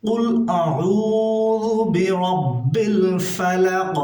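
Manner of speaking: slow, melodic chanted recitation; reciting Surah Falaq for students; Quran recitation. Shown as a demonstration. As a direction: stretch each word in chant. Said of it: "এখানে গুণনা হবে ইকফা গুণনা".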